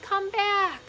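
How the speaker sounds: high, loud desperate call; shouted. A narrator's reading. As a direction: call after someone far away. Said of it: "she shouted".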